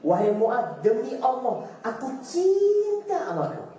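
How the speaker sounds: high, slow, heartfelt oath of love; preacher voicing the Prophet's loving words to a companion; heartfelt declaration. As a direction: lift it high and slow to show devotion. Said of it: "sambil mengatakan".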